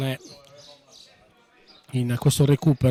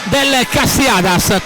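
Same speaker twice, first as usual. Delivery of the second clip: breathless italian goal commentary; 7 semitones higher than usual. Real, not acted. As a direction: yell the equaliser as the ball hits the net. Live goal call for equaliser. (telecronaca del gol urlata)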